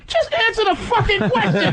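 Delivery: high, impatient puppet snap; squeaky mock bunny demanding an answer. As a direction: cut him off like a rude puppet. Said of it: "and the bunny goes".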